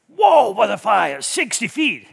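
loud, drawn-out shout of amazement; awed exclamation. Acted. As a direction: cry out as if staring up at a huge blaze. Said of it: "And then we go".

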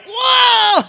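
loud, high shouted whoa of shock; startled whoa. Retold or imitated. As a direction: yelp it out, caught off guard. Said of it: "I'm like".

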